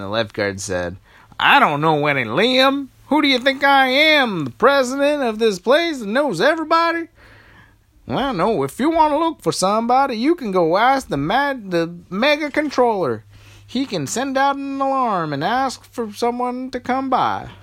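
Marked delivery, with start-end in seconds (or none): 1.4-7.1 high, loud, indignant lifeguard voice; grumpy lifeguard. Acted. Lifeguard brushing off the princesses, high and indignant. 8.1-17.5 high, loud, brisk lifeguard voice; helpful lifeguard. Acted. Lifeguard sending the princesses to the controller, high and brisk. Lifeguard points them onward.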